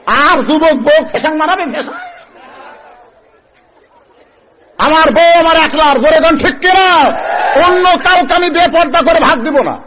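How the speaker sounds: high, loud haranguing sermon; full-force preaching. Acted. Preacher scolding young husbands before the crowd, loud and fiery. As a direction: hammer each phrase out to the crowd.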